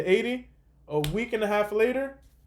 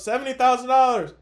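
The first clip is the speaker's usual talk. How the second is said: higher, louder mock holler; retold yell; 6 semitones higher, 6 dB louder than usual. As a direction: imitate her yelling it to mom. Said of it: "And then she yells".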